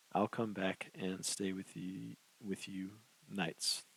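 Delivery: slow, halting, effortful; every word costs her effort, slowly; struggling to talk. Acted. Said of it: "It was very hard for her to talk".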